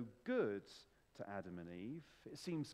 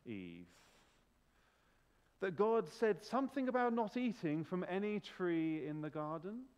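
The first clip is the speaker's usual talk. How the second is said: hammed-up posh voice, big falls in pitch; posh Satan voice. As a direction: play a posh devil with long, coaxing pauses. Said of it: "I imagine he's got a posh voice".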